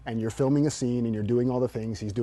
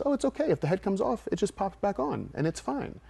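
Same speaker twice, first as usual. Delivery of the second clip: put-on soothing child; 8 semitones higher, 3 dB quieter than usual. Uncle soothing crying child.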